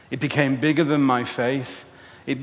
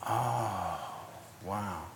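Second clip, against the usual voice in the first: very soft, low, drawn-out wonder; quietly stunned, acted realisation; hushed awe; 5 semitones lower, 14 dB quieter than usual. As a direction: let it sink in, almost under the breath. Acting out a dawning realisation.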